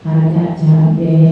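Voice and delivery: low, slow drawn-out words; distracted worshipper's thought, deep and slow; rambling congregant. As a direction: drift off into chili prices. Distracted mind mid-prayer.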